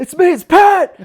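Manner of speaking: loud high identifying shout; retold shouted identification; calling out. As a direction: call out your name so he knows. Identifying himself to officer.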